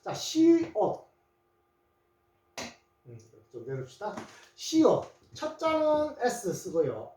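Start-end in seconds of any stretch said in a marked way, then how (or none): 0.1-1.1 시옷 in two drawn-out syllables, 시 then 옷; sounded out. Shown as a demonstration. Teacher introducing the letter to learners, sounded out slowly.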